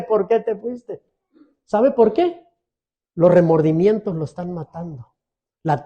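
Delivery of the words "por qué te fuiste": softer, slow, sinking echo of the cry; imitating a grieving relative, trailing off; fading mock wail. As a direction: let the plea die away quietly. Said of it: "Llore y llore".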